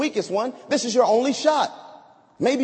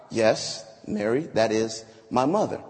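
calm answer; 10 semitones lower than usual. Acted. Jesus answers low.

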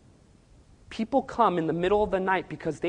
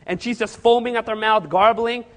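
usual speaking voice

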